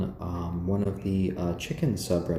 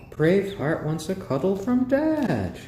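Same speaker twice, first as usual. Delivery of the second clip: cooing to a pet; 11 semitones higher, 5 dB louder than usual. Real, not acted. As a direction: coo it to the bird like a proud dad.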